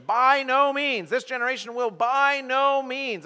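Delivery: "By no means" high, slow and weighty; emphatic negation. Shown as a demonstration. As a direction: hammer each word of "By no means". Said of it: "there's an emphatic negation".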